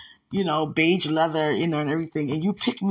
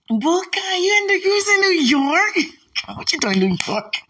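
high, loud delighted shriek; very high, very loud squeal of joy; excited shriek; 14 semitones higher, 5 dB louder than usual. Real, not acted. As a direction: shout it out overjoyed. Renewed excited outburst.

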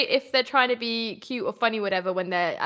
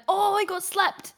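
drawn-out, high cry of excitement; excited shoutcaster; 8 semitones higher than usual. Retold or imitated. Co-host mimics shoutcasters seeing a sleep dart land, excited. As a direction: imitate a thrilled shoutcaster, pitch lifted. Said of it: "shoutcasters get really excited about".

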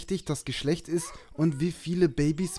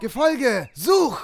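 high, loud call to the animal; dog command; 8 semitones higher, 5 dB louder, much slower than usual. Acted. Ordering the pet Gefolge to track the scream: loud command. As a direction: call the creature to go search.

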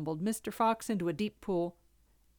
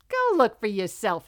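rude; 2 semitones higher, 7 dB louder than usual. Acted. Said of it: "said the rude Rooster".

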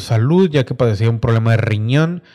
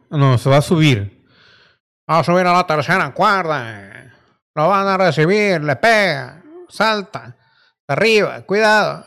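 wrestling commentator; 3 semitones higher, slower than usual. Acted. Live play-by-play of wrestling video.

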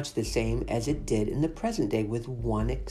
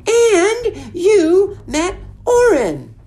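indignant, rising to a cry; complain, voice breaking high; huffy outburst; 12 dB louder than usual. Acted. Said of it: "huffed Madeline".

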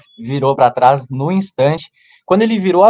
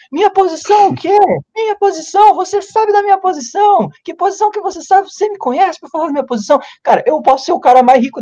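mock indignant yell. Retold drunk shouting back at girl.

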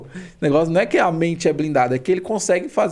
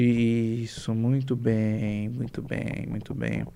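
hypnotic induction; 5 semitones lower than usual. Acted. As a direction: lull them down into trance. Hypnotic suggestion.

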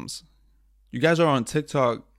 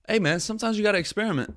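much higher, fast, airy excuse voice; mock breezy excuse; 8 semitones higher, faster than usual. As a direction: toss it off like a carefree dude. Mock excuse for failed live.

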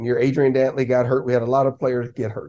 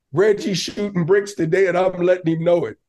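ordinary talk, nothing marked

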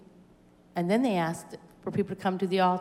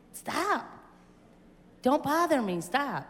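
higher-pitched retold complaint; mock protest; 6 semitones higher, slower than usual. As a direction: act out wanting to be left alone. Retelling protest at being grabbed.

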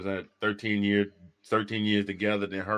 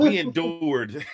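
laughing; 5 semitones higher, 6 dB louder, much slower than usual. Real, not acted. Corrects word, laughing.

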